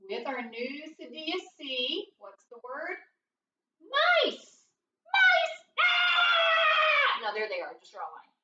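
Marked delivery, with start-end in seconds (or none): 3.8-7.2 high squealed shriek at mice; mock fright. Teacher pretends to spot mice, mock scream. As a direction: play at being scared, loud and very high.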